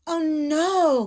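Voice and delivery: high, loud gasp of dismay; shocked cry. Acted. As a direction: gasp it out in alarm. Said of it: "Goldilocks gasped".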